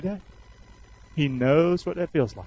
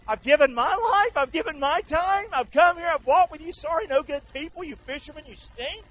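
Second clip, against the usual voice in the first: imagined hurt complaint; 11 semitones higher than usual. Acted. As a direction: scold the friends who let you down. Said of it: "Maybe he didn't talk like that".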